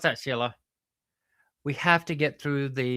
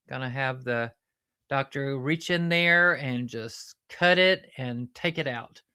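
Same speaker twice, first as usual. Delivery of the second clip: ordinary talk, nothing marked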